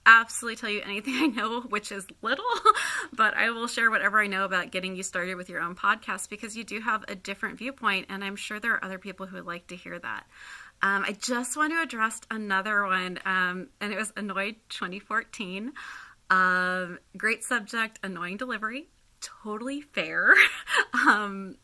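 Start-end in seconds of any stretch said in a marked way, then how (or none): 2.5-3.1 short high burst of laughter. Real, not acted. Laughing at her own lack of podcast know-how. 20.4-20.9 sudden high giggle burst; burst of laughter. Real, not acted. Laughing after admitting the critic was fair.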